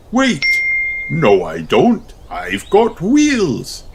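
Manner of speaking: high wait then slow pleased reveal; sudden idea. Acted. Daddy realises he can scoot, pleased. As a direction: discover the answer with delight.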